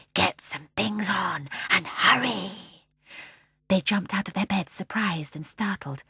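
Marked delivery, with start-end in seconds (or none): 0.1-2.5 slow, drawn-out calling voice; low mysterious voice. A narrator's reading. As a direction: urge them on slowly. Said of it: "a low voice".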